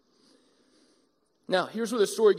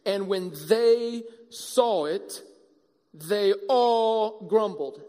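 slow scripture reading; 2 semitones lower, much slower than usual. Shown as a demonstration. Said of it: "Verse seven, here we go".

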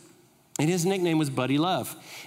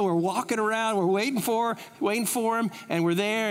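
ordinary talk, nothing marked

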